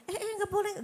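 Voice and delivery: high mock scold at a grabbing kid; tut-tut a child reaching for food; scolding mum. Mother stopping a child grabbing food, sharp scold.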